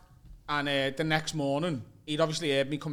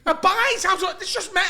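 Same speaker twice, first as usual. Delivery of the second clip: mock disgusted outrage; 10 semitones higher, 5 dB louder than usual. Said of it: "he's proper screaming at me".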